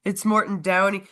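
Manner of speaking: ordinary delivery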